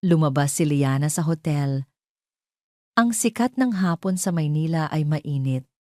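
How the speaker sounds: usual speaking voice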